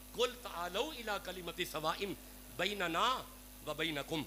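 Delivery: slow chanted recitation; intoned Arabic verse; Quranic recitation. Real, not acted. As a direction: draw the verse out slowly.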